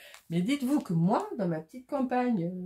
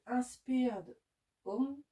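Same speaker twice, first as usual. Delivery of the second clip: slow, careful English accent; French speaker carefully putting on English; 4 semitones higher, much slower than usual. Real, not acted. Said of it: "j'ai un super accent".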